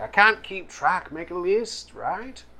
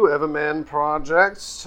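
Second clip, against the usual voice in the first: ordinary delivery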